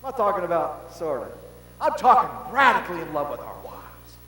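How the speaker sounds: loud, high preaching build; shouting sermon passion; fiery preaching. Acted. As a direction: preach it with full fire. Preaching at full force.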